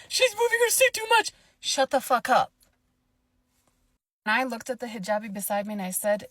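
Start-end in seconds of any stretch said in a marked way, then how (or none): 0.1-1.3 much higher, louder whiny mimicry; mocking whiny voice. Mocking the rude lady's complaint. 1.6-2.5 louder, higher dismissal; retold snapping at her. Speaker tells lady to shut up.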